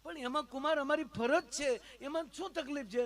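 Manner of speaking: high sweet motherly reply; mother-in-law voice. Acted. Mother-in-law answers son-in-law in high motherly tone.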